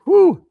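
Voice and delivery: very high, loud whoop; hype woo. Real, not acted. Host firing himself up mid-show, very high loud whoop. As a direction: let out a big whoop to pump yourself up.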